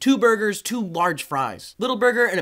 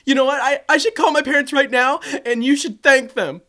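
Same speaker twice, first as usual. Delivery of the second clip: a hint of an indignant girl, louder and higher; 5 semitones higher, 5 dB louder than usual. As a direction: lecture them like a wronged brat. Girl scolds them.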